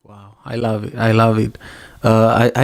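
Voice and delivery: ordinary talk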